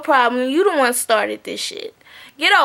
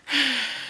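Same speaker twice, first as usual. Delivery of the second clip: resigned sigh; 8 dB quieter than usual. Real, not acted. Vlogger done recapping the audition, resigned sigh. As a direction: sigh quietly, unimpressed.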